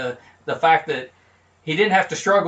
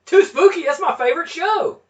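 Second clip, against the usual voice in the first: overexcited fan; 12 semitones higher, 4 dB louder than usual. Acted. Over-the-top excited fan.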